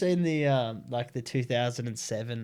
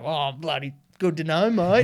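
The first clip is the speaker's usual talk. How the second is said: cheery Aussie dad, higher and louder; imitating his dad; 5 semitones higher, 7 dB louder than usual. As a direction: ham up a chuffed old Aussie bloke. Dad's reply retold on phone.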